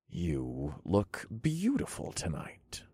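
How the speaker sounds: soft tender whisper. A narrator's reading. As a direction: breathe it close in her ear. Whispered compliment while dancing.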